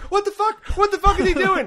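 high, loud outraged yell; retold screaming. Player yelling over the headset at fossil thieves, screaming. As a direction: scream it in disbelief. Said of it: "I was screaming".

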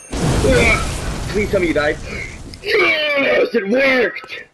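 high, loud yelling at the screen; shrieking at the game; excited shout. Real, not acted. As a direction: cheer it out.

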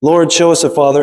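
ordinary talk, nothing marked

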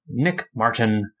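ordinary delivery

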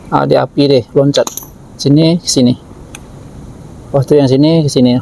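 normal talking voice

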